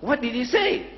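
retold impatient prodding, high-pitched; high, insistent mock impatience. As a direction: demand the answer as an exasperated questioner. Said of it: "the Reverend again started stamping".

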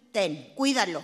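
low, curt mother voice; mother handing over the baby. Retold or imitated. Mother handing the baby to a six-year-old, low and curt. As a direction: toss it off low like a busy mom. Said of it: "mi mamá sí".

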